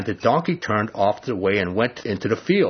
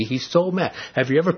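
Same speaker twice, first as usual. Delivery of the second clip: ordinary talk, nothing marked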